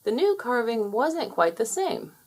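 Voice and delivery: ordinary delivery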